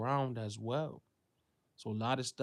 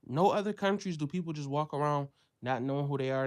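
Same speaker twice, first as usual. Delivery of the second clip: ordinary talk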